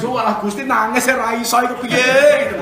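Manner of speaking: mock wailing. Preacher imitates himself complaining to God, mock wailing.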